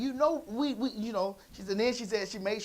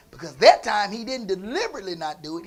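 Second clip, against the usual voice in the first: usual speaking voice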